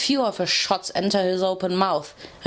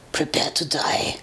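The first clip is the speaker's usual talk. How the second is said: furious dragon whisper; 4 semitones lower than usual. A narrator's reading. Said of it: "he growled".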